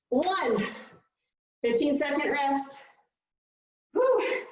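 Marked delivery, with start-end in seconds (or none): 3.9-4.4 relieved exhale. Real, not acted. Exhaling after hard interval.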